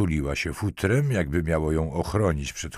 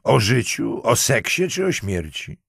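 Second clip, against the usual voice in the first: higher, louder taunting question; scornful snort; 6 semitones higher, 5 dB louder than usual. A narrator's reading. Marquise sneers at the lieutenant's demand to talk, scornful. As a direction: fling each option back mockingly. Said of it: "prychnęła".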